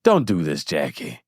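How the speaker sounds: imitating Heavenly. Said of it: "and Heavenly is like".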